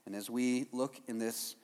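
soft, low prayer voice; hushed opening prayer to Jesus. Real, not acted. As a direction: pray quietly and sincerely. Leads a prayer.

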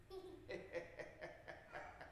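breathless high cackle; unvoiced evil cackle; witch cackle. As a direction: let the threat dissolve into a wicked laugh. Witch's threat trails into laugh.

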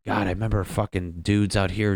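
ordinary delivery

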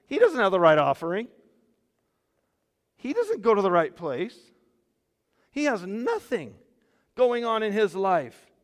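loud, high, incredulous objection; outraged crowd disbelief. Acted. Preacher voicing the crowd objecting to Jesus' choice, loud incredulity. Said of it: "everybody in the crowd went, What?".

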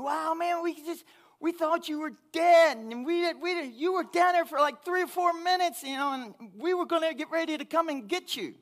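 high, loud rush of relieved friends; excited relief. Retold or imitated. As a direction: blurt it like you thought he drowned. Friends shout in relief on shore.

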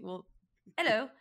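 a Southern dad greeting; Southern accent. Retold or imitated. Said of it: "the best part about the Southern accent".

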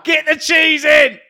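loud, slow shout. Real, not acted. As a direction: bellow it out slowly. Shouted joke cheese demand.

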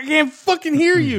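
mock shout. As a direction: holler it at someone who can't hear. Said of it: "you just roll your sleeve up and be like".

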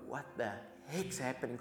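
soft, awed disbelief; quiet, overwhelmed questioning; acted hushed bewilderment. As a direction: say it under your breath, stunned. Acting hushed awe at heavy presence.

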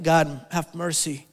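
ordinary talk, nothing marked

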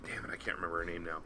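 fast frustrated whisper under his breath; whispered to himself. Real, not acted. Guest whispering to himself, unable to recall her name. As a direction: hiss it out, annoyed at his own memory.

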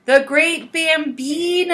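movie quote. Retold or imitated. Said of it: "biggest pop culture references".

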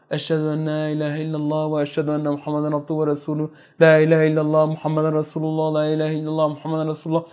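soft, low murmured recitation for the dying; reciting the shahada. Retold or imitated. A companion prompting the dying man with the creed, softly. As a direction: murmur the creed gently beside a deathbed.

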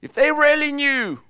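high, loud mocking accuser; accusing voice. The enemy taunting a churchgoer, high and loud. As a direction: sneer it like the enemy whispering doubt.

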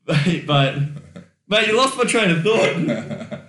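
real laugh breaking into the words; laughing protest. Real, not acted. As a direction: complain while cracking up. Cracked up by the accent joke.

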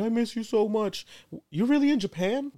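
high-pitched imitation of a weepy girlfriend; mock crying. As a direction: whine it tearfully, well above your own voice. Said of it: "She's crying".

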